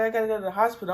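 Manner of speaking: high, pleading retold wail; mock panicked demand; panicked plea. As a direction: whine it like a scared kid. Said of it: "I'm screaming. I'm crying".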